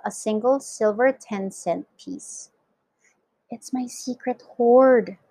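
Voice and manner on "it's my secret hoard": ordinary delivery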